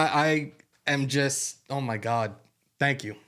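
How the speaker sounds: ordinary talk, nothing marked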